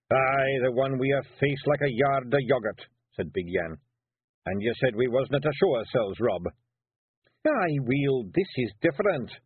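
gruff little Scots warrior caught out; Feegle Rob. Acted. Rob defending himself to the lads, Scots pixie voice.

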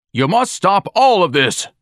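shouting. A narrator's reading. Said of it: "Ignatius shouted to Dorian".

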